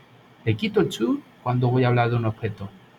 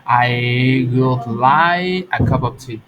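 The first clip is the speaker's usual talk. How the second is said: slow example sentence; 6 dB louder, much slower than usual. Shown as a demonstration. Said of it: "say something like this".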